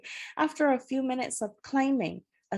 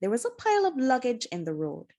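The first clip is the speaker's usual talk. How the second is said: plain reading voice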